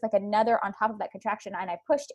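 usual speaking voice

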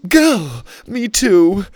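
sudden high startled cry; startled yelp. Acted. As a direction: jump and yelp it out. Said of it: "Lainey said with a jump".

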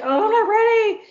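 high, loud flustered protest; mock panic. Caught off guard by his call, retold high flustered yelp.

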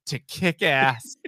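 slow, low tough-guy line; deadpan hero line. Retold or imitated. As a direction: drag it out like a cool action hero. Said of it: "looks at her and goes".

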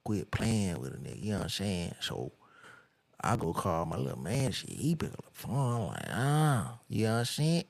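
mumbled, slurred rapper parody; mock rapper talk. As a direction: imitate an inarticulate rapper telling a story. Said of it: "this is how they talk".

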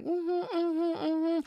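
high, sing-song hums of fake agreement; fake polite mm-hmm. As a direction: hum along sweetly while holding it in.

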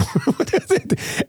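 high, light chuckle; laugh. Real, not acted. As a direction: laugh at your own joke. Storyteller laughs at own joke.

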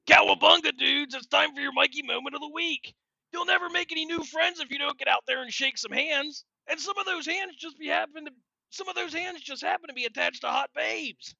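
high, loud surfer-dude turtle voice; Michelangelo voice. Acted. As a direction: hype it up like a party-dude turtle. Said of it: "give you guys a Mikey moment".

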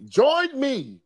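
high, grand mock invitation; hammed-up opening of her story; dramatic quote reading. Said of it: "She says".

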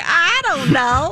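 high, slow clueless drawl; Lex. Retold or imitated. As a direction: imitate an oblivious friend, drawn out. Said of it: "Lex is like".

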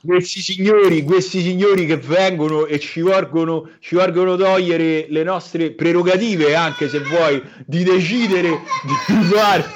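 put-on swaggering Roman tough kid; coatto kid impression. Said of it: "facci l'imitazione del bambino coatto".

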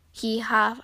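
plain reading voice

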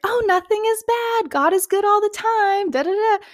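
high, sing-song forced positivity; mock an always-happy believer; fake cheerful voice. Mocking forced constant cheerfulness.

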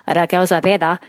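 Raquel Zapata with rolled Spanish vowels, ah-KEL sah-PAH-tah; Spanish accent. Shown as a demonstration. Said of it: "that's my best Spanish accent".

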